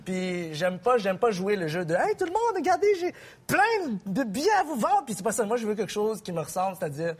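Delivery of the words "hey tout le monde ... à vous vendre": ticket hawker. Retold or imitated. Mocking a show's ticket hawker.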